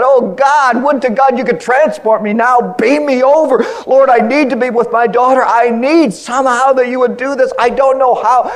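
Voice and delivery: loud, high anguished plea; retold desperate night prayer; crying out to God. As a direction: beg God with all your heart. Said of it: "crying out to God".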